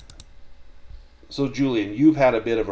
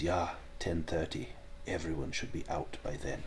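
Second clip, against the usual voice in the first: put-on low, hushed German-accented voice; 4 semitones lower, 10 dB quieter than usual. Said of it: "And the German voice says".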